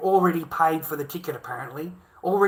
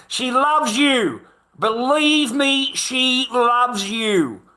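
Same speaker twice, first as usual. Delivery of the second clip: slow, loud insistence, pitched up; slowed-down emphatic reassurance; emphatic, drawn out; 7 semitones higher, 6 dB louder, much slower than usual. Real, not acted. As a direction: lean hard on every word to convince him. Insisting the wife loves him.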